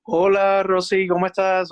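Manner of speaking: ordinary delivery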